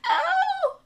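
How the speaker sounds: single very high, loud shriek; scream. A narrator's reading. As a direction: shriek a long "oh". Queen screaming in the story.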